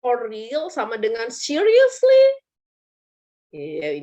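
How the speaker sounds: usual speaking voice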